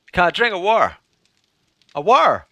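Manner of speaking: "war" meaning water, T left out; Norfolk-style "war" with a swallowed T; Norfolk dropped T. Shown as a demonstration. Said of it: "they don't pronounce T's or T H's".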